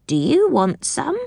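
high, rising goblin question; goblin offering. A narrator's reading. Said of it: "said the goblin".

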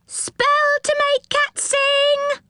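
extremely high, sustained street cry; piping, drawn-out gnome call; high-voiced gnome hawker. A narrator's reading. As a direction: squeal your wares across the market, slowly. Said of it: "cried another gnome".

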